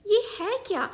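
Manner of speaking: high, loud puzzled question; playfully confused; mock confusion. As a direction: act baffled by the word. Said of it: "बहुत ही confusing शब्द है".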